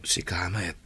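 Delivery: whisper. Acted. Marshall answering Olive.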